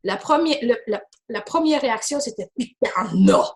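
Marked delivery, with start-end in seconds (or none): 2.6-3.4 deep booming voice from the tomb; voice from beyond the grave. Retold or imitated. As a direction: growl it like a ghost from the grave. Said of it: "Avec une voix d'outre-tombe".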